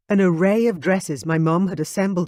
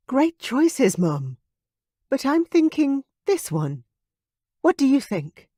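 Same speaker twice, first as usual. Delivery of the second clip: put-on young woman's voice; 10 semitones higher, 4 dB louder than usual. Veronica's voice as narrator.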